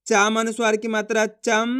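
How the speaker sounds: spelled out, ending on चम with a held half म; half-m nasal. Shown as a demonstration. Teacher demonstrates half-m to learners, held चम. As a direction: recite the sign, then hum the m closing चम. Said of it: "आधा माँ का उच्चारण कर रहे हैं".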